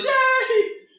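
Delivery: put-on high, loud cheer for a toddler; cheering yay. Parent cheering their fallen toddler, high excited yay. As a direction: cheer big like a proud parent. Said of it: "We'd be like".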